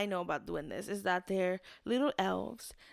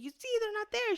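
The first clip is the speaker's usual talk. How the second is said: high-pitched mock protest; insist, acting out being wrongly sure; retold insistence; 13 semitones higher than usual. Woman showing sister the keys are missing, mock protest.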